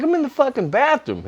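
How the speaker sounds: high, exasperated retold reply; high-pitched annoyed shout from the bathroom; exasperated retort. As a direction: fire it back, fed up.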